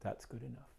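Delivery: very soft, almost whispered to God; softly content, speaking to God; quiet prayer. Retold or imitated. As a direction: let it fall to a hush. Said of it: "Okay, God".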